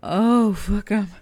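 panting and out of breath. Real, not acted. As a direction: gasp the words out of breath. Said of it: "I gotta catch my breath".

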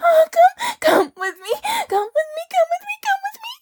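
high, loud breathless begging; climactic pleading. Acted. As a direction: build to a high, urgent cry. Acted climax of intimate scene.

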